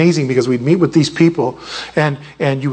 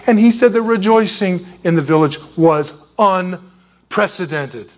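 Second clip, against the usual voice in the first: ordinary talk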